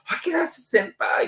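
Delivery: high, slow, sweet call of a junior student; imitating a junior. Junior student at a drinking party calls his senior, in a high eager voice. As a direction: play the admiring kouhai calling his senpai. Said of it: "大学の後輩とかと一緒に飲んだったりしてて".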